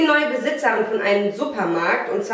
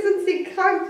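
high mimicking voice; high retold question; mock receptionist; 11 semitones higher than usual. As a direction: quote her in a high mock tone. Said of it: "sagt sie zu mir".

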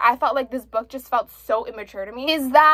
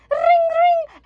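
high, loud mock ringtone; phone ring imitation; 4 dB louder than usual. Mimicking the phone that interrupts the couple, shrill mock ring. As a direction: chirp out the ringing phone.

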